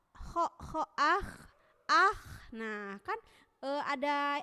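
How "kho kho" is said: "Kho, kho" with a long airy rasp; letter kho demo. Shown as a demonstration. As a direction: breathe out the kho, letting air flow. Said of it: "Contohnya misalkan huruf sin ya, huruf atau huruf kho".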